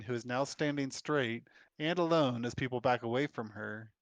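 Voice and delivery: normal talking voice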